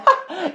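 imitated friend's high, bright cackle; cheerful laugh. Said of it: "She was so cheerful".

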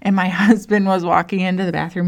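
normal talking voice

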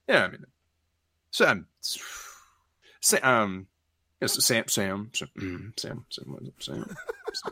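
halting admission fading to a near-whisper; stall, then mumble the name into nothing; embarrassed mumbling orc. Acted. Bashful orc admits who killed him.

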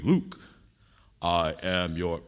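low, slow Vader line cut short; deep slow villain reveal, stopping mid-line; Darth Vader. Retold or imitated. Preacher replaying the Vader reveal that cuts off. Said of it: "And you go".

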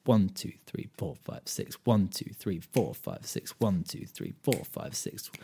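a teacher counting six eight with a strong one; count six eight, accent on one. Shown as a demonstration. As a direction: punch each one, breathe the others. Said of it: "often the feel is".

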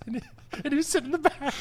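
high, helpless laughter through the words; can't get it out for laughing; laughing hard. Real, not acted. Telling the punchline about the kid, cracking up.

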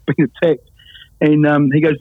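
ordinary talk, nothing marked

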